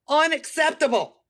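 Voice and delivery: loud outraged verdict. Shown as a demonstration. Outraged over a broken fingernail, loud. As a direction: throw Unacceptable out with indignation.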